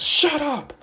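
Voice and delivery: loud, very high "shut up"; high-pitched mock outburst; angry high yell. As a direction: yell it back in a fit. Kacchan snapping at teasing.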